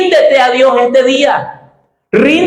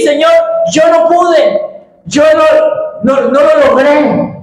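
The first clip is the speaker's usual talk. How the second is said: voiced as a broken believer, higher and strained; pleading surrender; 4 semitones higher than usual. Preacher voicing a believer giving up to God, pleading. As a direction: plead to the Lord that you failed.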